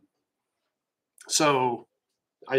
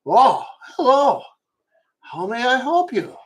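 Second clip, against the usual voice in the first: put-on a higher, louder old man's greeting; old camp father; 8 semitones higher, 4 dB louder than usual. Bald old father figure greets the stranger at the campfire, higher friendly old voice. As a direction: play the kindly bald elder looking up from the kids. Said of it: "and he goes".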